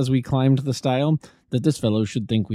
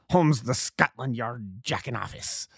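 slow, sneering slur; scornful insult; 2 semitones higher, 4 dB quieter, slower than usual. A narrator's reading. Roylott sneering at Holmes. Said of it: "Roylott insulting Holmes".

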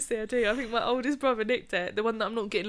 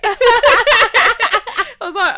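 laughing; 12 semitones higher, 14 dB louder, much slower than usual. Real, not acted. Laughing through retold reply.

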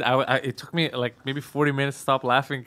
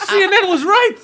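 very high, loud alarmed yell; mock panic; 9 dB louder than usual. Scared governor running out, hammed-up panicked yell. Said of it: "governor went running out of the building 'cause he got scared".

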